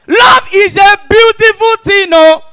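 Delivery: high, loud proclamation; exuberant shout. Acted. Comedian proclaiming love to the crowd, exuberant shout. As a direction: belt it out to the whole room.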